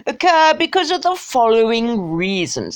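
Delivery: badly faked snotty English accent; bad snooty English toff impression; really bad accent. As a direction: do a hammed-up upper-class English accent. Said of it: "That's a really bad accent".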